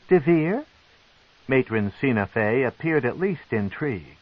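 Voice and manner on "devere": a sharp, curious lift on the name; matron's question. A narrator's reading. Matron hears a surprising name, intrigued.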